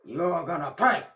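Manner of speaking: loud vengeful shout; Big Klaus loudly vowing revenge; angry threat. Acted. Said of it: "Big Klaus yelled".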